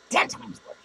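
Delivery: Ten times worse, breathed as an unvoiced whisper; whispered echo. Podcaster echoing his taunt to listeners, whispered aside. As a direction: echo it under your breath.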